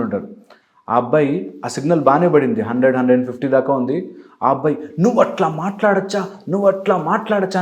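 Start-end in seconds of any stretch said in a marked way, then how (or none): 5.0-7.6 mock yell, much higher; retelling a man yelling into his helmet phone, a mild mock shout; imitating a shout. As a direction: ham up the angry biker on the phone. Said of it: "అని గట్టి గట్టిగా అరుస్తున్నాడు".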